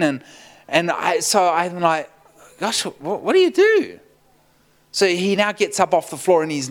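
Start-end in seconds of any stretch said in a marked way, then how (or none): 2.6-4.0 mock-flustered, jumping up very high. Preacher at a loss beside a wailing man, mock-flustered. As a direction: squeak out a helpless question.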